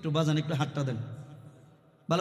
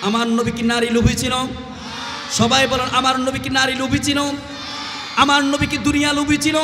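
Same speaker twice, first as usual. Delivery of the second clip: level-toned chanted questions, gradually rising; rallying preacher; 7 semitones higher, 4 dB louder than usual. Real, not acted. Preacher prompting the crowd to deny slanders, rallying chant. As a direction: call out each question for the crowd.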